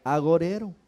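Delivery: loud, measured Bible reading from the pulpit; scripture reading. Acted. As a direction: proclaim each verse to the whole church. Reads scripture aloud to the congregation. (lectura bíblica en voz alta y solemne)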